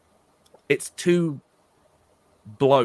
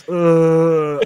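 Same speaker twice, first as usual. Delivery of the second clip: disgusted ugh; 5 semitones higher, 9 dB louder than usual. Retold or imitated. Disgusted groan at the mask.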